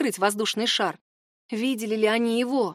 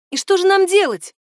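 loud, high, urgent question; alarmed question; 10 semitones higher, 7 dB louder than usual. A narrator's reading. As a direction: ask urgently, voice raised. Milan hears the alarming news.

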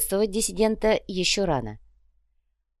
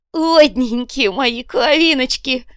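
high, loud, drawn-out hail; orator's greeting; 7 semitones higher, 6 dB louder, much slower than usual. A narrator's reading. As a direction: cry out lovingly to the whole crowd. Копыта greets his kinsfolk from car.

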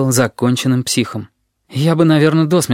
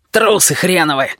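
higher-pitched scornful rebuke; scolding; 5 semitones higher than usual. A narrator's reading. Lary crawling out berates his helpers, mildly angry.

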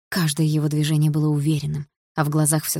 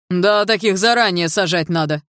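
gruff passer-by; 6 dB louder than usual. A narrator's reading. Man passing agrees harshly.

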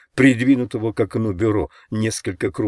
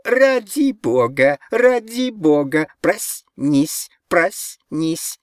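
very high, slow eerie chant; high drawn-out jeering refrain; mocking chorus; 9 semitones higher, 3 dB louder, much slower than usual. A narrator's reading. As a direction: echo it like a taunting mob. Jeering chorus echoes the plea.

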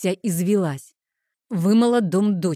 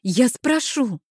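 high-pitched hurried promise; exasperated, raised-pitch assent; exclaiming; 5 semitones higher, slower than usual. A narrator's reading. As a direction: give in, voice lifted. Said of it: "воскликнула я и отшатнулась".

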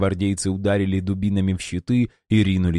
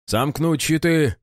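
barked slow command; gruff barked parade orders; 9 semitones higher, slower than usual. A narrator's reading. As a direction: bark it at the troops. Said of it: "гаркнул сержант".

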